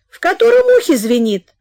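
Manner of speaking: high, loud question; mother calling out. Acted. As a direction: yell it across rooms. Mother shouting from her room. (мать громко кричит из комнаты)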